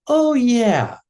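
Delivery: imitated child suddenly recalling; dawning realization. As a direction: let it slowly dawn, imitating a little kid. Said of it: "then it dawns on him".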